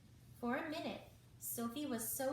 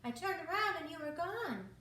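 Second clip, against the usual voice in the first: worried friend; 7 semitones higher, 4 dB louder than usual. A narrator's reading. Hattie still worried, out of breath.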